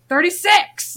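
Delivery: high, loud, frazzled blurt; blurted answer. Retold or imitated. Frazzled trainer answering a question she doesn't understand, blurted high. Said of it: "And I was like".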